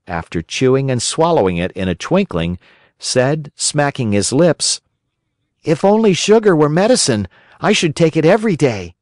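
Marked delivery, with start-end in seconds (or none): 5.6-8.9 put-on much higher, louder childish whine; whiny puppet boy. A narrator's reading. Pinocchio refuses the fairy's bitter medicine, whining.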